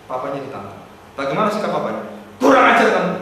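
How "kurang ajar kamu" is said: loud, scolding father; father scolding his son, loud mock anger; harsh rebuke. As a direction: snap at the son.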